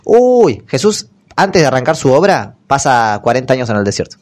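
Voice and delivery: usual speaking voice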